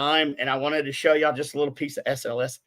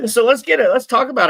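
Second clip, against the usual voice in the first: bursting with a laugh, hyped up; excited; 10 semitones higher, 12 dB louder, faster than usual. Real, not acted. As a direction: shout it out with a laugh.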